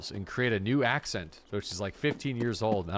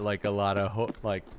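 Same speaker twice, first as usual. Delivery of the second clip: ordinary delivery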